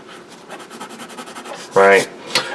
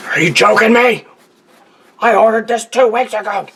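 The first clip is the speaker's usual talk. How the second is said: higher, louder cartoon grump voice; voicing a cartoon character; 13 semitones higher, 4 dB louder than usual. Drawn character complaining about a late order, high indignant squeal. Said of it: "He's like".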